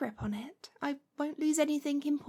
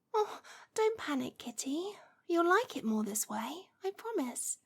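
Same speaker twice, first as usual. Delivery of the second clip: hushed, intimate witch speaking close to the ear; gentle hypnotic murmur to a new familiar. Acted. Soft roleplay voice throughout.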